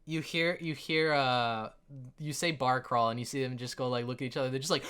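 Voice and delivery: ordinary talk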